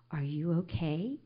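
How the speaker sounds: hushed, low, near-whispered question; gentle stranger. Retold or imitated. Stranger with hand on her shoulder, hushed and gentle. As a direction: imitate tender concern, very softly.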